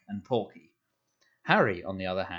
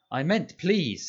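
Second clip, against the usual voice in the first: higher, hurried correction; quick and anxious; 7 semitones higher, 4 dB louder, slower than usual. A narrator's reading. Boy hurriedly correcting himself to his uncle, higher. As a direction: rush to take it back. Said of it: "said Harry quickly".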